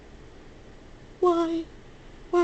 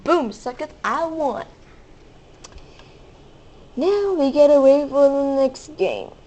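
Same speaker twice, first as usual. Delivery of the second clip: ordinary talk, nothing marked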